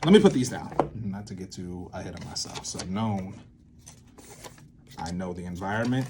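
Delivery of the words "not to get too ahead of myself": normal talking voice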